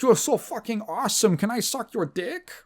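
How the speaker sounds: high mock-gushing voice; fawning NPC. Grateful villager praising the player, high mock adoration. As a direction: gush over the hero like a starstruck fan. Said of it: "they're like".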